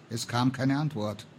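usual speaking voice

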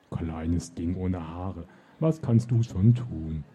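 very slow, low giant voice, rising at the end; mocking giant. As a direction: rumble it out like an amused giant. Giant mocks the witch.

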